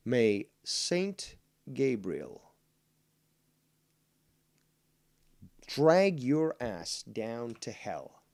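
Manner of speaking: slow solemn curse; mock incantation. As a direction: intone it like a priestly curse. Mock incantation cursing a troll.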